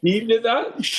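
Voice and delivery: high, slow amazed question; surprised high drawl. Real, not acted. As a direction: gasp it out in disbelief. Surprised reaction.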